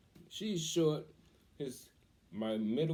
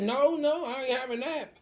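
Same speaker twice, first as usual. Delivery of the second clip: much higher and louder refusal; mock defiant shout; 7 dB louder than usual. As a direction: shout it down, high and loud. Daughter refusing the other moms.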